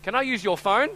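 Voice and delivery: high, loud child impression; little daughter's voice. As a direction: wheedle as a little girl asking a favour. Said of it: "I hear this little voice in the back".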